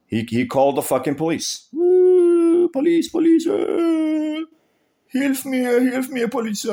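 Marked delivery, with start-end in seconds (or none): 1.7-6.7 squeaky high mock cry for help; hammed-up falsetto panic, mocking the neighbor; mocking panicked neighbor. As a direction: whine for the police like a scared coward. Mocking neighbor calling police.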